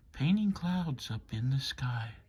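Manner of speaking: usual speaking voice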